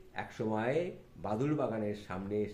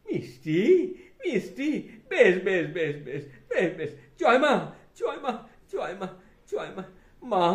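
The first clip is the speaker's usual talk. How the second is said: laughing, raised ecstatic delight; joyful Thakur; 9 semitones higher, 8 dB louder than usual. Acted. Thakur rejoicing at reaching the ocean, lightly voiced. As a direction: exult like a child who reached the sea.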